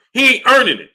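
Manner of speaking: heated exasperated shout; frustrated outburst. Real, not acted. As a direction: bark the verdict out in frustration. Heated rant about boxing politics.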